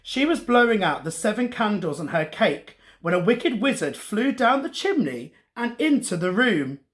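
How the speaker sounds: ordinary delivery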